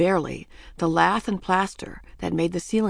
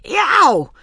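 high, loud pained yelp; escaping yelp; 9 semitones higher, 9 dB louder than usual. A narrator's reading. Ramona pulled from hole, high pained yelp. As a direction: let a sharp cry slip out. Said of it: "escaped from Ramona".

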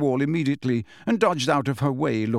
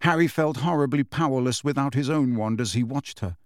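plain reading voice

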